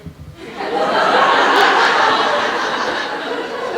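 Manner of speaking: big high burst of laughter; loud cackling laugh. Acted. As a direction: roar at the joke.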